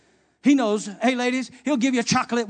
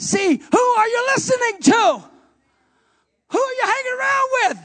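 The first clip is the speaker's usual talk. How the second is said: much higher, louder shouted questions; preacher's challenge; 8 dB louder than usual. Acted. Pastor challenging God's people, shouting.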